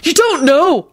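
high, shouted protest; heated objection; indignant shout. A narrator's reading. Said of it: "Neville shouted as he stood up".

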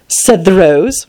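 ordinary delivery

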